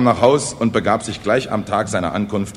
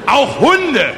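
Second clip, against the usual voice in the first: high, loud, gleefully overexcited patter; carried-away village orator; 9 semitones higher, 5 dB louder than usual. Acted. Kosonossow boasts of accidents to the peasants, carried away. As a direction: reel off disasters with rising zest.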